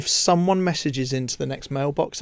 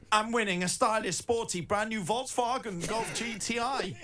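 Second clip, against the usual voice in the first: King Julian accent; 6 semitones higher, 10 dB quieter than usual. Shown as a demonstration. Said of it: "like King Julian".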